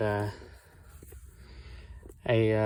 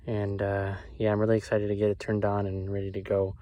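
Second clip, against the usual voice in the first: usual speaking voice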